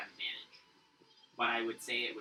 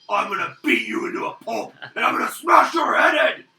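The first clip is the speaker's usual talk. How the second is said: higher and louder, building to a roar; furious troll threat; 10 semitones higher, 16 dB louder than usual. Acted. Troll turns on the player who killed his drake, furious roar. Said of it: "looks towards you and goes".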